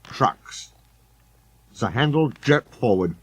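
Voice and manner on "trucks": firm quiet repeat. A narrator's reading. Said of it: "his driver repeated".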